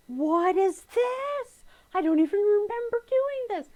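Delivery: high, loud, drawn-out mock astonishment; acted bafflement. Quilter unpacking a forgotten project, hammed-up bafflement. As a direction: stretch it out in exaggerated disbelief.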